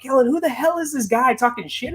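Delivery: mock-indignant, pitched well up; indignant caller. Targeted listener phones the host to complain, mock indignant. Retells irate callers phoning in.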